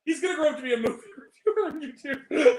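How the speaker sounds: real laughter breaking into the line; laughing. Real, not acted. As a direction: crack up as you deliver the joke. Joking while both speakers laugh.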